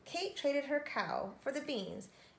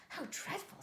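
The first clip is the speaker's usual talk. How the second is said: hushed; 8 semitones higher, 5 dB quieter than usual. A narrator's reading. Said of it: "said Kate".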